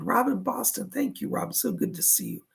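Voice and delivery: ordinary delivery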